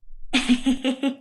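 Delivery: a short jeering laugh; mocking laugh. Party guests jeering at the silly dresses, short laugh. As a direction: laugh at their outfits.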